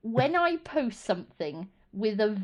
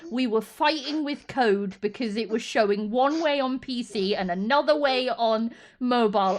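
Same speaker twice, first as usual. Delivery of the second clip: ordinary delivery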